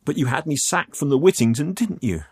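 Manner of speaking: high, emotional accusation; accusing. Acted. Youth accuses man over the sacking, aggrieved. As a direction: press the charge, upset.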